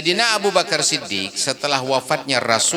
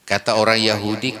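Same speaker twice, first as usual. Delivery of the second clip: usual speaking voice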